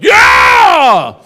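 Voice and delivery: huge high shout, held long; sarcastic whoop. Shown as a demonstration. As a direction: whoop it up to make the room squirm. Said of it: "you gotta whoop it up".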